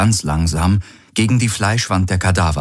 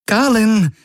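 calling out. A narrator's reading. Kirai calls Galen, worried and confused. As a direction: call him, confused and worried. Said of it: "rief Kirai".

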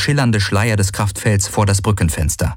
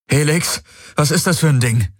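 higher-pitched call, only mildly raised; 6 semitones higher than usual. A narrator's reading. Galen to the Helix over proton fire, calling out. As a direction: call out in a higher voice, no real shout. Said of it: "rief er über das unentwegte Zischen".

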